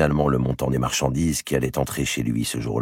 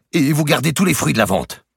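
higher, louder urgent prelate; pleading archbishop; 13 semitones higher, 7 dB louder than usual. A narrator's reading. The archbishop bargains with the merchant, high and urgent. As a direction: press the offer hard, higher.

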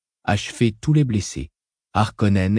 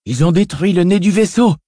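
much higher, louder panicked yell; 9 semitones higher, 5 dB louder than usual. Acted. A soldier yelling to all that the ship's nose is destroyed, loud panicked yell. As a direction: scream it over the roar. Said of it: "hurla une voix".

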